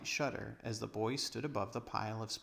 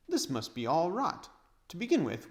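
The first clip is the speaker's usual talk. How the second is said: put-on scornful boy; 7 semitones higher, 5 dB louder than usual. Edmund's scornful objection.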